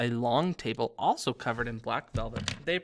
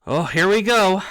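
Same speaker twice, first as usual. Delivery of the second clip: loud, raised-pitch grumble; bitter; 6 semitones higher, 12 dB louder than usual. A narrator's reading. Ghost host hears the hunting horn arrive, bitterly. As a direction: complain bitterly at full volume. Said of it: "said Nearly Headless Nick bitterly".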